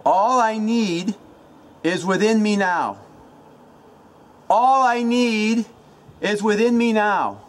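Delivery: high, loud, drawn-out chant; shouted incantation. Shown as a demonstration. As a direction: belt it out slowly like a rallying mantra. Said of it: "you say it out loud".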